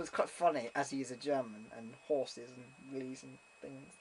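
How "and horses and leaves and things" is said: usual speaking voice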